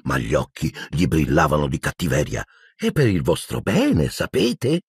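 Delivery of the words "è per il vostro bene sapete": mock concern. A narrator's reading. Peeves taunting the students.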